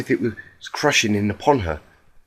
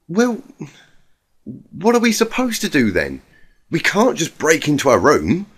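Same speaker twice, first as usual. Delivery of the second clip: much higher, helpless questioning voice; Twilight, at a loss; 6 semitones higher, 4 dB louder than usual. A narrator's reading. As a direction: throw up your hands, lost.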